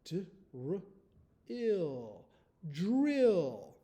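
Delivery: emphatic dictation. Shown as a demonstration. Teacher calling the spelling word drill. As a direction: Drill called out loud. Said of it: "the word is drill".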